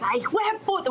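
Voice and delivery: high, emphatic "Ay, hijo de puta" with feeling; emotional curse. Retold or imitated. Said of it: "with, like, so much emotion".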